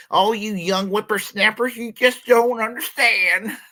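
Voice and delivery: put-on high, loud creaky old geezer voice; very old man. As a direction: scold like a cranky old man. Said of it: "getting to my old man voice".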